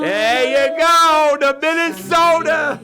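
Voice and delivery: high, loud and slow burst; getting hyped watching the screen; excited. Real, not acted. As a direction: shout it out as the team appears. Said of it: "I'm getting excited".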